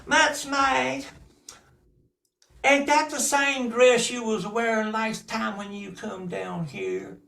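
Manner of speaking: put-on high, loud, sneering girl voice; mocking cousin. Cousin mocking Mutzmag's dress in a high sneer. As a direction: look down your nose at her. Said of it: "they'd sit in on her".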